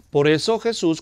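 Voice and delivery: normal talking voice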